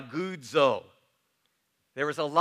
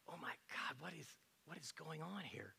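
hushed astonished crowd; 23 dB quieter than usual. Retold or imitated. Crowd stunned at Jesus, softly murmured disbelief. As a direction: whisper it like a stunned bystander. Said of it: "they were like".

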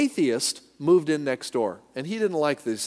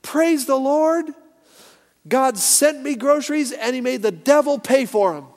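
unfazed praise; 10 semitones higher, 6 dB louder than usual. Retold or imitated. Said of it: "she just said".

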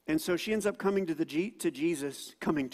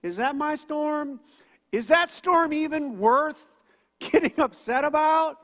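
incredulous; 10 semitones higher, 8 dB louder than usual. Acted. Rhetorical questions about storms.

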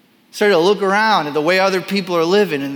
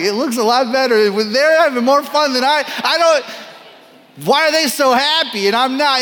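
envious whine; 6 semitones higher than usual. Retold or imitated. Envious of others' vacation photos.

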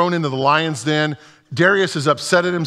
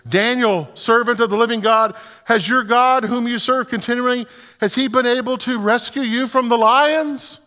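anguished call; 9 semitones higher than usual. A narrator's reading. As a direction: cry out to Daniel in anguish, raised and strained. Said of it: "he calls out in an anguished voice".

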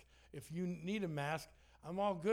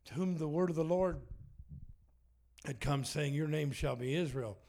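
louder, preached Bible reading; scripture reading; 4 dB louder than usual. Acted. Pastor reading Elijah's altar story to the church, louder. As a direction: proclaim the verse to the room.

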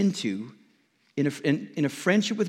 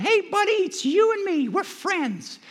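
much higher, chirpy little ant voice; tiny ant voice. Pretend. Tiny ant cheerfully greeting the huge person above it, high squeaky voice. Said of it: "I saw an ant looking at him going".

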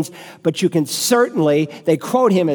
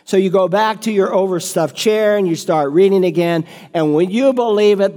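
ordinary talk

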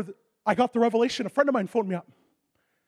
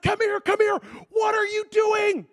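much higher and louder, panicked; alarmed shout; 12 semitones higher, 6 dB louder, slower than usual. Retold or imitated. Medical staff yelling at the injured player skating, panicked. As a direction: yell them back off the ice.

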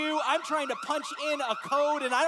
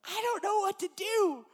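mock panic; 7 semitones higher than usual. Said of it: "I'm like".

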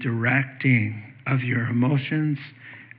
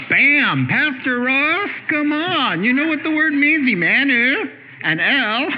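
much higher, slow, playful booming voice of the Lord; God speaking; 11 semitones higher, 3 dB louder than usual. Retold or imitated. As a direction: tease the pastor like God nudging him. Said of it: "the Lord just went".

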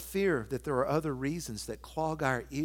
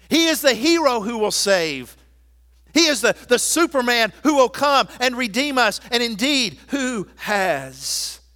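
loud, high, proclaiming preacher voice; full-force preaching; 8 semitones higher, 9 dB louder than usual. Acted. Pastor rousing the church about conquered enemies, full-force preaching. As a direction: preach it out to the whole congregation.

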